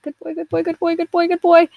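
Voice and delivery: high excited chant; flustered squeaky voice. Retold or imitated. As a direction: squeal it like a blushing happy pup. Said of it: "blushing and he's like".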